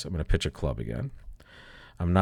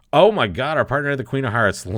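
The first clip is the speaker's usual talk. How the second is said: higher, louder, delighted gasp at the lucky card; excited outburst; 7 semitones higher, 7 dB louder than usual. Real, not acted. Teacher thrilled that partner held the queen, excited outburst. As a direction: let it burst out, thrilled at the news.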